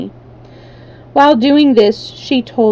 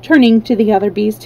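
ordinary delivery

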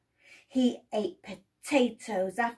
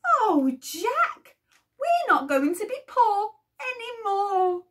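overjoyed cry; 10 semitones higher, 6 dB louder than usual. Acted. Mother overjoyed at gold.

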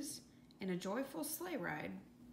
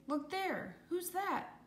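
high, excited calling out; storybook excitement, mildly called out; shouted alert; 6 semitones higher than usual. A narrator's reading. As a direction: point out the newcomer in a raised pitch. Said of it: "shouted Leslie".

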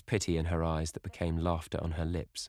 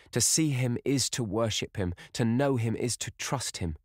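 higher-pitched, rapturous devotion; adoring young sister; 6 semitones higher than usual. Acted. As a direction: voice a girl besotted with her beloved. Sister adoring her beloved.

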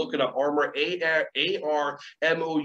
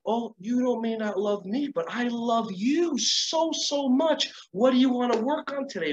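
high, sweet, gushing reassurance; teacher answering a child's rejection with affection; loving teacher reply; 7 semitones higher than usual. Acted. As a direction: pour love on the kid in a bright high voice. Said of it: "the teacher can go".

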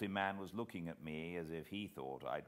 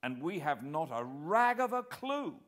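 raised, higher cry of alarm; startled exclamation; cried out; 9 semitones higher, 5 dB louder than usual. A narrator's reading. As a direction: blurt it out in dismay. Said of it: "I cried".

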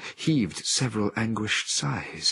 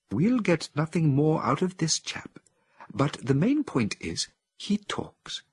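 higher, hushed voice, half whispered; whispered ape scientist; 9 semitones higher than usual. A narrator's reading. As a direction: whisper the result in awe. Cornelius voiced in story.

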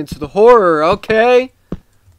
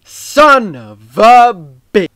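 frustrated curse; 2 semitones higher, 8 dB louder, much slower than usual. Real, not acted. Frustrated gamer swearing at the game.

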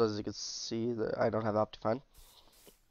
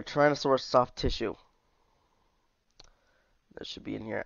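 usual speaking voice

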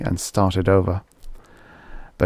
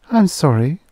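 repentant; 8 semitones higher than usual. A narrator's reading. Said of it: "She looked repentant".

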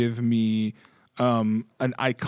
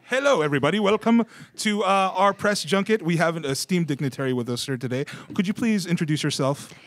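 higher-pitched formal welcome to the press; press junket host; 6 semitones higher, 3 dB louder than usual. Pretend. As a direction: announce the guest like a press-conference moderator. Opens pretend press junket as host.

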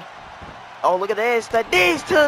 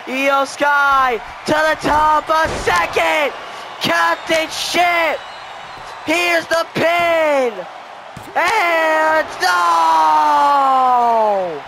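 excited call; 2 semitones higher, much slower than usual. Real, not acted. Commentator calling another near fall, excited shout. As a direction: build it then explode on no. Second finisher and pin.

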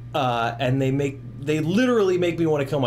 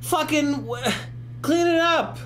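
much higher squeaky whining; high mocking whine; mock whiny complainer; 11 semitones higher than usual. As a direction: mimic a fussy complainer, pitch way up. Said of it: "bitching and moaning".

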